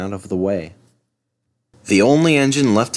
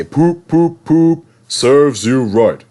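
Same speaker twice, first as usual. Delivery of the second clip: taunting; 5 semitones higher, 5 dB louder, much slower than usual. Acted. Gordon taunting Henry.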